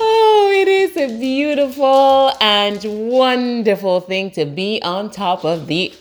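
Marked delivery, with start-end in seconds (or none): 0.1-4.0 joyful cry. Real, not acted. Joyful morning exclamation.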